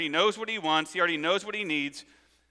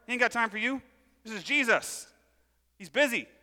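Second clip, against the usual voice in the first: higher-pitched mock shushing; dismissive crowd; 5 semitones higher than usual. Crowd hushes the begging blind man, mock dismissive. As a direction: play an annoyed crowd brushing someone off. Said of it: "And everyone's like".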